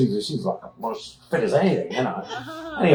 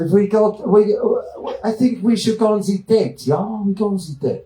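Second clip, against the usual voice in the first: mock Austrian accent; 8 semitones higher than usual. Babsy voiced with Austrian accent.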